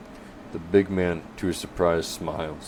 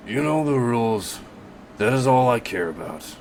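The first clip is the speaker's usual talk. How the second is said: stern butcher; 6 semitones higher than usual. Acted. Casper states his rule.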